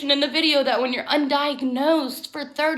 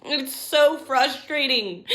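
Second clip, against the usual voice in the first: ordinary talk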